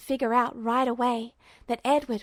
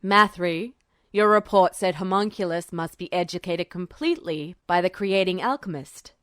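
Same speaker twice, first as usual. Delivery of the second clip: lightly voiced general; 6 semitones lower than usual. General addressing alchemist.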